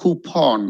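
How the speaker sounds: coupon as slow "koo-PAWN"; preferred pronunciation. Shown as a demonstration. Teacher summing up coupon, preferred pronunciation. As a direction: draw out the second syllable of coupon. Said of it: "So it's called coupon".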